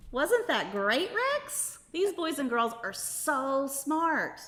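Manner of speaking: upbeat, sing-song host voice; kids show host. Acted. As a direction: talk brightly to a room of kids. Host addressing children throughout.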